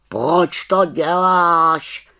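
high, slow croaking question; raven asking. Acted. Raven asks the witch why, high and slow. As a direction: drag out the raven's puzzled croak. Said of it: "zeptal se Abraxas".

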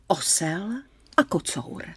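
usual speaking voice

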